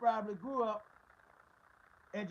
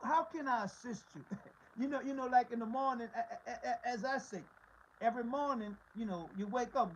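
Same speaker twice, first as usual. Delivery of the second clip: ordinary talk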